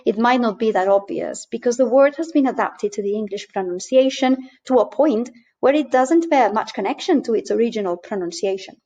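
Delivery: ordinary talk, nothing marked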